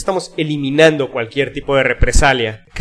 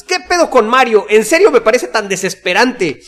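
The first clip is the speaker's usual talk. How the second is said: exasperated quote; 7 semitones higher than usual. Retold or imitated. Reads an irritated listener's comment.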